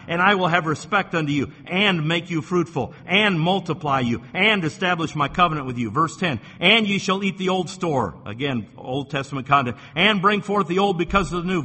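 loud, ringing pulpit scripture reading, every phrase driven home; preacher reading verses to the congregation, loud and fervent; full-force preaching. Acted. Preacher proclaiming God's promises to his church, at full force.

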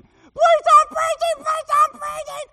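high, very loud, slow wailing scream; screaming plea. Retold or imitated. Kneeling man screams at the preacher to stop, piercing scream. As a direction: scream it, begging, drawn out.

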